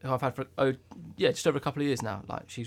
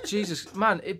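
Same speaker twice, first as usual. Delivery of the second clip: usual speaking voice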